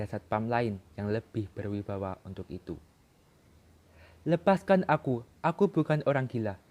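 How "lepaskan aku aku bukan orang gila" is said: loud desperate yell, higher pitch; shouting. Acted. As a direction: shout it out, pleading to be freed. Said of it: "teriak Joe".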